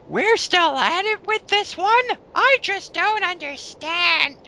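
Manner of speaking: high, slow mocking read of her comment; mock her words in a high put-on voice. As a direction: sneer it out in a squeaky high voice.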